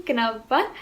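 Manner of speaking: ordinary talk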